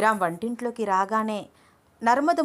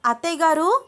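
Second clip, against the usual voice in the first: higher, louder call across the house; sudden raised call to the next room; 7 semitones higher, 5 dB louder than usual. A narrator's reading. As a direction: call out to distract her. Calling out to mother-in-law.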